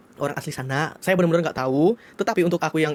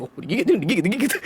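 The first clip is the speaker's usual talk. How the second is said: laughing; 4 semitones higher than usual. Real, not acted. Laughing while retelling the bite.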